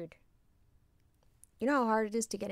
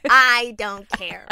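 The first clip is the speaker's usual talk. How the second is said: loud, slow stubborn refusal; defiant refusal; 12 dB louder, much slower than usual. Retold or imitated. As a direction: plant your feet and refuse.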